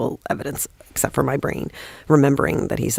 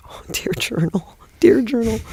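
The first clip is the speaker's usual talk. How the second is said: giddy dear journal; 8 semitones higher than usual. Retold or imitated. Student thrilled by praise, whisper rising to a giddy cry. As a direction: hush it, then burst out thrilled.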